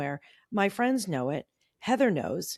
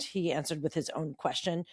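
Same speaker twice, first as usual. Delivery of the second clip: ordinary talk, nothing marked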